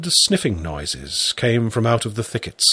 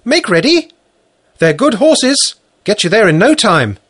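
calling out; 11 semitones higher, 7 dB louder than usual. Acted. Farm wife calls out.

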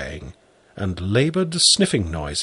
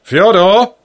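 loud high call; 10 semitones higher, 9 dB louder than usual. Acted. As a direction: call across the garden. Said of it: "I called".

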